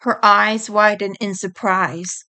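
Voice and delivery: ordinary delivery, nothing marked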